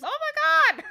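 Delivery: very high shocked squeal; stunned princess. Acted. Princess hearing the magician's revenge, squealing high in shock. Princess learns she was kidnapped.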